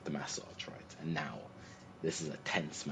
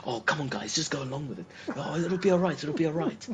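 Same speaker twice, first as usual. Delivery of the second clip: high, loud cajoling of the group; pleading reassurance, pitched up; mock coaxing; 8 semitones higher, 8 dB louder than usual. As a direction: imitate a friend urging everyone along. Friend coaxing the others to comply.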